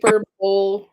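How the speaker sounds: hyperbole said firmly as "hyper-bowl"; mispronounced HYPERBOLE. Real, not acted. Host confirming the wrong reading, hyperbole as "hyper-bowl". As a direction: insist on hyperbole ending like bowl. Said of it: "I've been saying it wrong".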